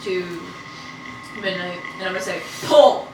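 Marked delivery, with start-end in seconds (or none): 2.7-3.1 commanding shout. Acted. Giving a command in character.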